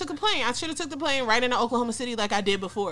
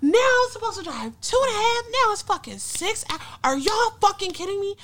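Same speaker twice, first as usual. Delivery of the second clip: angry rant; 9 semitones higher than usual. Retold or imitated. Ranting at siblings over changed plans.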